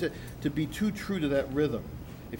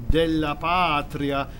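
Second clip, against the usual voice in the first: "patria" with a long stretched first syllable, "PAA-tria"; slow and lean into "patria"; drawn-out "patria"; length on patria; 6 dB louder, much slower than usual. Shown as a demonstration. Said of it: "you gotta give some length to patria".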